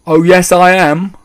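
put-on high, loud boyish pipe-up; cheeky mouse boy. A narrator's reading. Mouse boy contradicting his father, cheekily piping up. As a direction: pipe up loudly and proudly.

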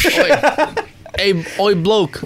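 a hammy London geezer voice; British accent. Retold or imitated. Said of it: "We cannot do British accents".

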